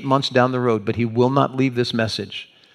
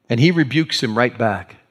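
ordinary talk

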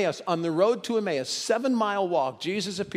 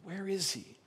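a soft, near-breathless "where is he"; hushed searching question; 3 semitones higher, 9 dB quieter than usual. Acted. Mary at the empty tomb, hushed and grieving. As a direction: murmur it while searching the tomb. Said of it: "And looking again".

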